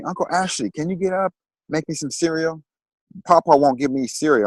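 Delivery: put-on pleading like a five-year-old asking for cereal; little nephew. Small nephew voiced in story.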